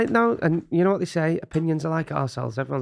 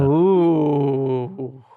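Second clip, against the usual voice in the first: teasing ooh; 4 dB louder than usual. Real, not acted. Teasing threat reaction.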